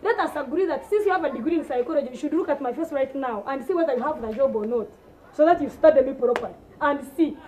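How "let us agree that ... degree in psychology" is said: ordinary talk, nothing marked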